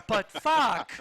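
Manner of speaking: put-on high, loud childish burst; kid who just found the F word. Husband imitated as a kid blurting a swear. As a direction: blurt it like a seven-year-old's first swear. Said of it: "sounding like a seven-year-old who just found the F word".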